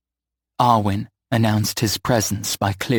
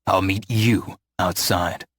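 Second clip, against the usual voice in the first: lower, slow and dark; darkened voice; 4 semitones lower, slower than usual. A narrator's reading. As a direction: let the warmth drain out of it. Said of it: "his voice darkened again".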